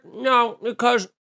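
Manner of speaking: high voice through a jutted jaw; Steve Carell fake-chewing. Shown as a demonstration. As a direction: talk round food that isn't there. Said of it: "nobody talks like that".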